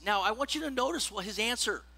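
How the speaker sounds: ordinary delivery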